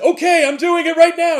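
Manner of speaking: high, loud, eager to obey; panicked compliance. Retold or imitated. Pawnbroker hurrying to obey the voice in his ear, panicked. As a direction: rush to comply out of fear.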